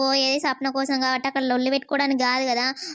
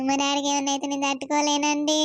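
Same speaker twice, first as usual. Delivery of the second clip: crying; 2 semitones lower than usual. Acted. Daughter crying for father.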